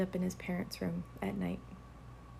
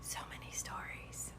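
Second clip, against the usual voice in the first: whisper; 11 dB quieter than usual. Real, not acted. Spooky closing aside.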